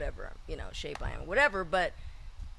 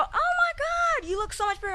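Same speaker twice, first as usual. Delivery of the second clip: gushing fan. Retold or imitated. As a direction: squeal it like an excited fan. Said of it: "you go".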